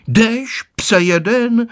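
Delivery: high, sharp mock scold; grandma shooing the dog away, high scolding cry. A narrator's reading. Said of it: "vykřikla babička".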